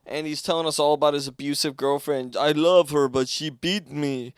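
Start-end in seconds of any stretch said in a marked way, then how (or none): 2.4-4.3 hammed-up Russian accent; mock Russian accent, building louder; Victor. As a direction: exaggerate a thick Russian accent. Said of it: "He didn't talk like that".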